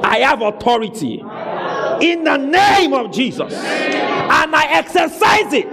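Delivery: high, full-force preaching shout; commanding shout. Real, not acted. Preacher leading the church's declaration, commanding shout. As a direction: proclaim it with total authority, stretching the last words.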